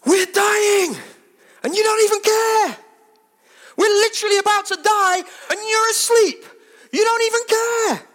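frantic high shouting, near screaming; desperate cry at a sleeping Jesus; panicked disciples. Acted. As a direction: yell it like men about to drown. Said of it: "And they're like".

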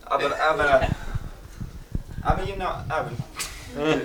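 a lightly put-on Italian accent; mock Italian accent. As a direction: play at being Italian, half-joking. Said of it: "so an Italian accent".

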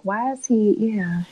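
ordinary delivery

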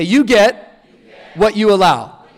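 loud, firm chant-leading delivery. Shown as a demonstration. Preacher having the crowd say it again, out loud. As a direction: split phrase, projected for repeating. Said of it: "Say this out loud with me".

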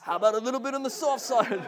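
high, laughing Aussie pitch; jokey put-on Aussie accent, breaking into laughter; mock Aussie accent. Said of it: "I can't do an Aussie accent".

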